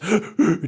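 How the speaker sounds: sharp high gasp of breath; gasping inhale. Shown as a demonstration. Showing the breath he took while holding back tears, sharp gasp. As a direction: demo one loud choked inhalation. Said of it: "just inhalations of breath".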